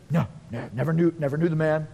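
low, quick, hushed brush-off; casual denial. Acted. Peter denying Jesus to a little girl, evasive denial. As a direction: deny it hurriedly under your voice.

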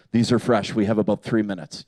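low, hushed mock tracker; man tracker. Six-year-old playing tracker over prints, low grave voice. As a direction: read the tracks gravely, dropping the voice. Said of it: "he's like man tracker".

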